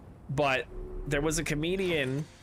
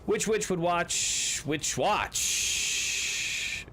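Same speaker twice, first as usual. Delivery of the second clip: very slow and high, each word spaced apart; slow tongue twister; 7 semitones higher, much slower than usual. Pretend. Player reciting a tongue-twister riddle to the table, very slow and high. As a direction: pick through every witch and watch slowly.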